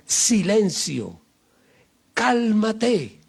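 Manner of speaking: Jesus on the stern rebuking the storm with authority; authoritative command. Retold or imitated. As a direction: order the wind and the sea to calm down. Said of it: "lo hizo con autoridad".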